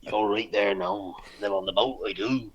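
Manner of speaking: hammed-up Cornish sailor accent; Cornish sailor teacher. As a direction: start slow and drawn-out like a Cornish boatman. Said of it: "they talk a bit more like that".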